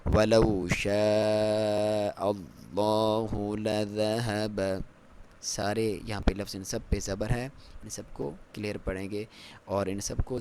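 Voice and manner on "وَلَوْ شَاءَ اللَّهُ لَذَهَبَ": slow drawn-out tajweed chant; Quran recitation. Shown as a demonstration. Teacher recites the verse for students, slow chant. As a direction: recite it slowly with full tajweed. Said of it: "موٹا پڑھیں گے اللہ کے لام کو".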